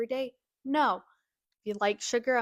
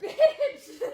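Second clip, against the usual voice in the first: laughing shout; 13 semitones higher, 9 dB louder than usual. Real, not acted. Reacting to scare, laughing.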